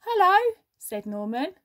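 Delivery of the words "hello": high, loud little greeting; loud high hello; eager hello. Acted. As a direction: pop it out brightly like a shy newcomer. Big Norman says hello.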